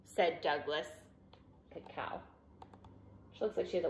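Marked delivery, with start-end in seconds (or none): none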